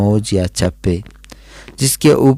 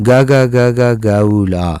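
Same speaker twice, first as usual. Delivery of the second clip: mimicked seagull call, ga ga ga; gull cry. Teacher mimicking the young gull's squawking cry. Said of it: "کی آوازیں نکال رہا تھا".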